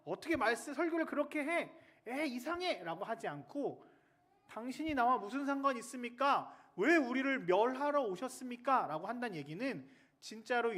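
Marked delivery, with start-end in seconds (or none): 0.0-2.8 high, loud, indignant challenge; brash hammed-up outburst of scorn; mock confrontational heckle. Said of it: "와서 첫 번째 한다는 얘기가". 4.5-8.8 high, loud pleading challenge; urgent imitated outcry; acted demon's protest. Demon confronting Jesus, acted.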